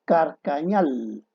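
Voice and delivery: Carcañal said slowly, as the wrong form; forma incorrecta. Shown as a demonstration. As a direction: lay out Carcañal slowly so the error is heard. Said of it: "es calcañal o calcañar". (Carcañal, despacio, como forma incorrecta)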